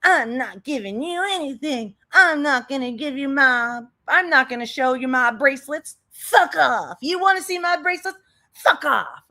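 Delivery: mock indignant refusal; exaggerated imitation of a defiant rant; imitating defiant woman. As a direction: mimic someone stubbornly refusing, pitch creeping up. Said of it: "where she's like".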